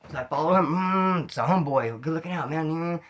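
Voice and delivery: ordinary talk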